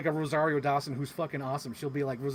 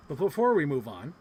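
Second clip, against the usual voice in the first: normal talking voice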